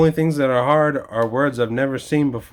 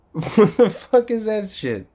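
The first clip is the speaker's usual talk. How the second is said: laughing disbelief; 8 semitones higher than usual. Real, not acted. Exasperated laughing rant.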